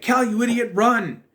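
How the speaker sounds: high, loud urgent yell; yelling at Cal. Retold or imitated. Reviewer acting out Eric yelling at Cal to run, loud. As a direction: yell at him to get moving.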